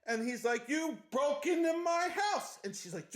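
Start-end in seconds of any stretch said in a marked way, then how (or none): 0.7-2.6 higher, slow mock outrage; indignant. Scotsman accusing the intruder, mock indignant. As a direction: stretch it out in high-pitched indignation. Homeowner in acted quarrel.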